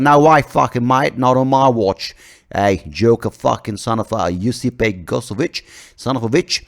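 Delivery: loud, slow, belligerent Aussie; mock Australian accent. Australian leader warning off a tennis star, mock Aussie accent. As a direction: square up in a broad Australian accent. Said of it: "because I'm Australian".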